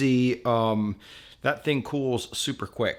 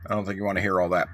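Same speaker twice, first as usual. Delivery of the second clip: ordinary talk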